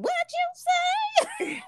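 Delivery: high, drawn-out mock disbelief; exaggerated slow squeal of shock. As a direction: stretch it out, scandalized. Acts out startled reaction to meaning.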